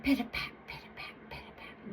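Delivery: whispered little footsteps; tiptoeing. A narrator's reading. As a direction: fade to a tiny tiptoe whisper. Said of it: "Little Daughter tiptoed toward the gate".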